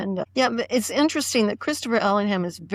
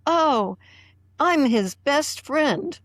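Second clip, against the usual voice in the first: higher, slow, gushing mock friend; lightly voiced eager Mark; a hint of an overeager Mark Milo; 7 semitones higher, slower than usual. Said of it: "Mark Milo was there saying".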